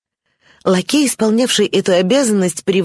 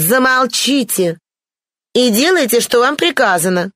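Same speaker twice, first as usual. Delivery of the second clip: imperious order; 8 semitones higher than usual. A narrator's reading. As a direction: cut the servant off imperiously, voice pitched high. Imperious Blanche silences the servant. (властный высокий окрик)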